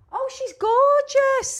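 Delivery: high drawn-out gushing; gushing coo. Real, not acted. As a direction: coo over the photo, stretching it out. Cooing over a photo.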